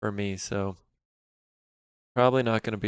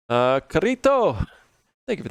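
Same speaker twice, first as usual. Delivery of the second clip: put-on light Spanish accent on the name; 4 semitones higher than usual. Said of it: "why I said that with the Spanish accent".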